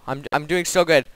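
normal talking voice

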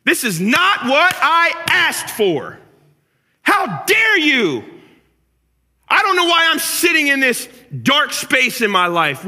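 loud, high, drawn-out cry of protest; indignant shouting, far above normal pitch; outraged at God. Acted. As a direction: rail at heaven, stretching every word. Acting out bitterness at God.

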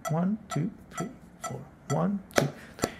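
counting beats aloud in rhythm; rhythmic beat count; counting the compás. Shown as a demonstration. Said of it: "let's start with the compás de tango".

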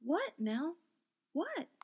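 questions leaping up in alarm; startled. A narrator's reading. Theodora sitting up in bed to Nell, startled. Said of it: "startled and disheveled".

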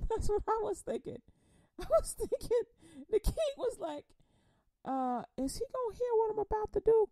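giggling high-pitched aside; laughing high. Real, not acted. Preacher laughing at his own joke to congregation. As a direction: laugh through it.

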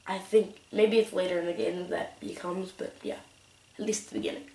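ordinary talk

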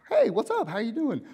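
high, upbeat hello with a lift; breezy phone greeting. Acted. Friend answering a call from the desert, breezy greeting.

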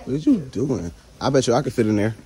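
usual speaking voice

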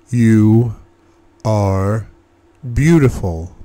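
"You", "are", "beautiful" each set apart by a pause; each word emphasized. A narrator's reading. Man insisting to his doubting lover, stressing "You", "are", "beautiful" separately. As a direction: land firmly on "You", then "are", then "beautiful", one at a time. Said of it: "I emphasize each word".

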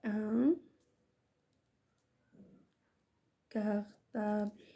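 slow, long pause after ان; word-by-word dictation. Shown as a demonstration. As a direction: say ان, wait, then stretch كارطابل slowly. (إملاء بطيء كلمة بكلمة)

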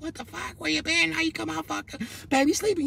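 high-pitched mock of an angry girlfriend whisper-scolding; baby mama scolding. Baby mama scolds him for coming home high, high mock voice. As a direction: squeak it up high like a mad girlfriend hushing over a sleeping baby. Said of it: "my B M start tripping on me".

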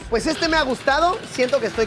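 chewing food between words; talking while chewing. Real, not acted. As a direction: mouth partly full. Seen 0: Lips pursed, jaw working as he eats from plate before close-up.

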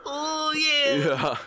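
very high laughing character voice; hammed-up high cartoon voice; squeaky caricature voice. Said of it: "pop culture thing is like".

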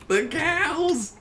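high squealing laugh; shrieking laughter. Real, not acted.

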